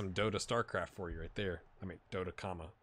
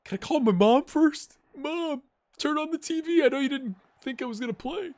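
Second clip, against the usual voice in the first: put-on young backup keeper told of his debut, squealing with joy; thrilled young player; 8 dB louder than usual. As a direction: squeal like a kid getting his big chance. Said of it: "He's like".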